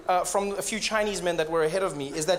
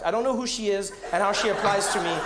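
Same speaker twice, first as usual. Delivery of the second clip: ordinary delivery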